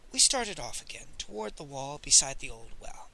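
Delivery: ordinary delivery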